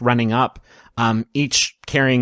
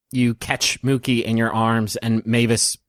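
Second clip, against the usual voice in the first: ordinary talk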